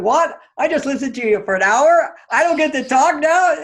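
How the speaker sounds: loud, high indignant outburst; outraged disbelief. Retold or imitated. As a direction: blurt it out, stunned and offended after an hour of listening. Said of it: "But I kept that inside".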